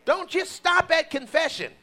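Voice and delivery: high, loud preaching, stretched out; full-force preaching. Acted. Preacher urging the congregation, at full force. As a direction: preach it out to the church at full power.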